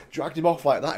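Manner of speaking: ordinary delivery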